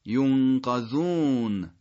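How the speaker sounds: ینقذون very slowly with open "qa"; careful recitation demo. Shown as a demonstration. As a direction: stretch ینقذون, long final "uun". (ینقذون کشیده، با قافِ مفتوح)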